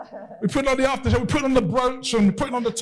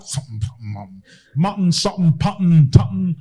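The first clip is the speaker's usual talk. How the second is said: cartoon dog muttering curses under its breath; Muttley mutter; 3 semitones lower, 5 dB louder than usual. Preacher doing Muttley cursing under his breath at his master. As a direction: grumble like a snickering cartoon dog. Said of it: "he'd be like".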